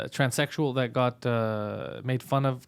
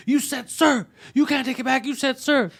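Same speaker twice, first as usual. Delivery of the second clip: much higher pitched repeated accusation; mock shrill accusation; 11 semitones higher than usual. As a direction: squeal it like a wounded customer. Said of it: "he's like".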